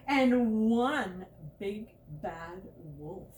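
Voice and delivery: loud, slow, dramatic; booming menace; big bad wolf boom. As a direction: land the wolf with weight. Ominous recipe climax.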